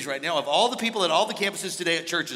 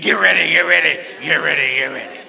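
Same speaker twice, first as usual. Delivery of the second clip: loud, urgent preacher's shout; T D Jakes; 10 dB louder than usual. Retold or imitated. Preacher quoting a famous preacher's rallying call, loud and urgent. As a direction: shout it in a quick repeated volley. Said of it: "that's why T D Jakes said".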